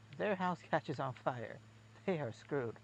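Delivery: ordinary delivery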